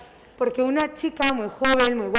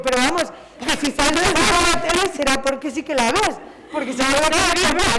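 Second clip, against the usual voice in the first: louder, higher, incredulous retort; retold exasperated retort; 3 semitones higher, 5 dB louder than usual. Author retelling what she told the reader, louder and exasperated. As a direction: spell out the obvious, exasperated.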